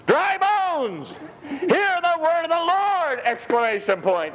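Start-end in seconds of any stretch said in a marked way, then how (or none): 0.1-3.2 high, ringing emphatic cry, 'Dry bones' lifted; emphatic proclamation. Acted. Preacher shows how Ezekiel must cry out to the dry bones, emphatic. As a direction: proclaim it with an exclamation point. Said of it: "Be emphatic about it".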